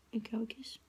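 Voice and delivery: soft hushed whisper; hushed coaxing whisper. Real, not acted. Coaxing baby for a kiss.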